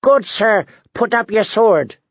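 high-pitched dwarf begging for mercy; comic high-voiced yell; screamed plea. A narrator's reading. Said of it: "the dwarf on the bottom screamed".

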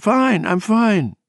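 high, slow, wavering insistence; Bobby. A narrator's reading. Woman about to collapse reassuring her friend, slow wavering croak. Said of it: "croaked in her wavering voice".

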